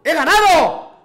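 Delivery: alarido triunfal, agudo y fuerte; grito de victoria. Real, not acted. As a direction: grita la victoria a pleno pulmón. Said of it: "Eso de los gritos". (grito eufórico real)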